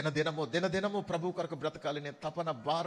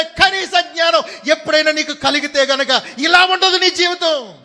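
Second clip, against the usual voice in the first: full-force preaching; 10 semitones higher, 19 dB louder than usual. Real, not acted. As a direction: thunder the warning at the congregation. (బిగ్గరగా, నెమ్మదిగా బోధించే ప్రసంగం)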